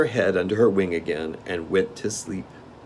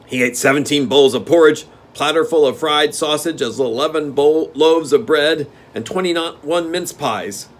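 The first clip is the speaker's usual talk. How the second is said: steward; 8 semitones higher, 8 dB louder than usual. Acted. Steward listing.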